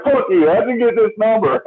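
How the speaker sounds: high-pitched mock yell; imitated angry yelling. Uncle answering a prank call, mock angry yelling. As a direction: imitate an outraged man shouting down the phone. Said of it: "He's, like, yelling at me".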